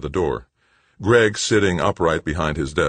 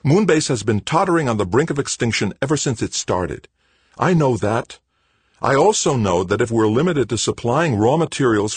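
raised insistence; 8 semitones higher, 5 dB louder than usual. Acted. Heated argument continues.

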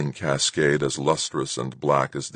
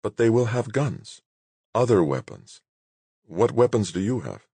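higher-pitched, mildly worried woman's voice; 7 semitones higher than usual. A narrator's reading. Woman warns Moonbase's leader about guns, mildly worried. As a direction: voice her concern, pitch lifted. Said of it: "She seemed genuinely worried".

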